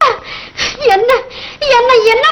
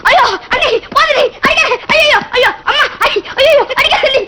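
howling and pleading under blows; hammed-up cries of pain; wailing; 4 semitones higher than usual. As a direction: beg for the beating to stop, wailing. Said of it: "கதறா".

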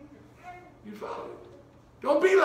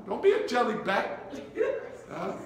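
usual speaking voice

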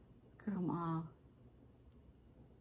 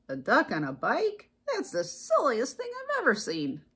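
incredulous cow; 3 semitones higher than usual. A narrator's reading. Cow privately judging Duck on his bike, incredulous cow. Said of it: "what she thought was".

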